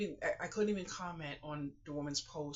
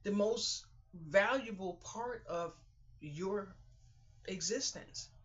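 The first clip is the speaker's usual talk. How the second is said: slow emphasis; 4 semitones higher, slower than usual. Real, not acted.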